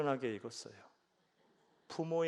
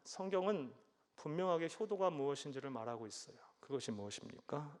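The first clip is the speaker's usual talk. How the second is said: normal talking voice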